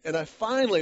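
normal talking voice

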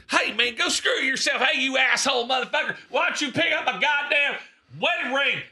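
high-pitched mock yell; imitated angry yelling. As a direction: heckle like an irate fan. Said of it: "he is yelling at me".

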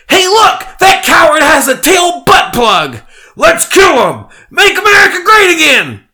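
very high, loud furious shouts; mob shouting. Acted. Furry spotting the drunk man, screaming very high. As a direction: rile up the crowd.

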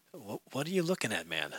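very soft, low muttered question; falter, then ask it under your breath; retold puzzled preacher, hushed; distracted aside. Distracted preacher asks the staring man.

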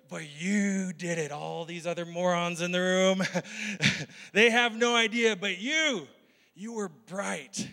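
hammed-up God praising a newcomer at heaven's gate; God welcoming. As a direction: play God proudly welcoming a soul. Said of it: "God welcoming someone into heaven".

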